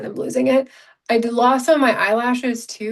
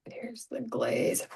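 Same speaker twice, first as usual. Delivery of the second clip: whisper; 12 dB quieter, slower than usual. Real, not acted. Whispered aside while applying makeup.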